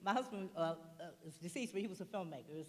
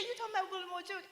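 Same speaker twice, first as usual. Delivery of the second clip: imitating Iyanla laughing; 13 semitones higher, faster than usual. Iyanla recognising the name, higher laughing fast. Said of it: "And she said".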